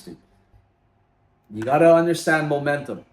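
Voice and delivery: ordinary talk, nothing marked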